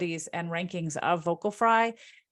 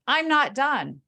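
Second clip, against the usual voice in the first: higher and louder, holding the floor; firm interruption guard; 6 semitones higher, 5 dB louder, slower than usual. Shown as a demonstration. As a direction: cut off the interrupter, firmly. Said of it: "signal to people when you're being interrupted".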